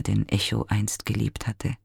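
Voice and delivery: plain reading voice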